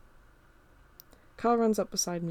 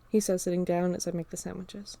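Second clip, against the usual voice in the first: ordinary delivery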